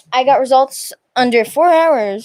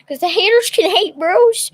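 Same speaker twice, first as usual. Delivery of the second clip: excited; 7 semitones higher, 4 dB louder than usual. Real, not acted. Hyped defiant shout-out.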